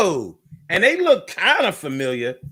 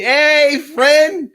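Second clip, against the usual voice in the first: much higher, louder overfriendly hey; mock chummy greeting; 9 semitones higher, 4 dB louder than usual. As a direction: play the overeager buddy. Fake friends greet him.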